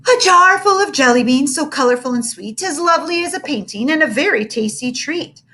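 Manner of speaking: lightly recited little poem; girl reciting a poem about jelly beans; waxing poetic. Acted. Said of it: "waxed poetic".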